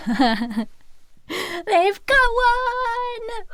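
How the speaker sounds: high, laughing "got one!"; excited squeal. Real, not acted. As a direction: burst out delighted. Thrilled by the ghost call.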